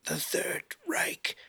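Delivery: slow, breathy whisper; very soft, drawn-out gasped words; dying whisper. A narrator's reading. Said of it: "The man whispers out".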